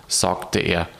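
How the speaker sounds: ordinary delivery, nothing marked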